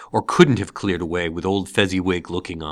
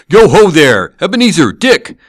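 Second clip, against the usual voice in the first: put-on much higher, loud hearty old boss calling; jovial call; 9 semitones higher, 11 dB louder than usual. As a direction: bellow a cheerful greeting to the apprentices. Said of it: "called out in a comfortable, oily, rich, fat, jovial voice".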